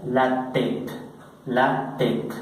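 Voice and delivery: "tête" as one syllable, "tet", no S; French pronunciation. Shown as a demonstration. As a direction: say "tête" with silent S and final E. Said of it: "pronunciar con este acento circunflex". ("tête" a la francesa, tet, sin ese)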